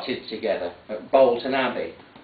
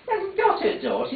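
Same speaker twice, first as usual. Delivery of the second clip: put-on high, indignant old woman; indignant mother; 4 dB quieter than usual. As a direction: she won't hear of it, denying it high. Mother repeats denial.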